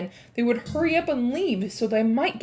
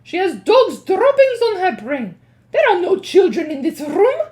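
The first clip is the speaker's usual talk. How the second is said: scornful witch; 9 semitones higher, 10 dB louder than usual. Acted. Witch scoffs at Mildred.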